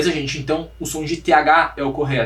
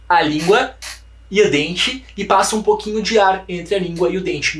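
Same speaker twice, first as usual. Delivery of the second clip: normal talking voice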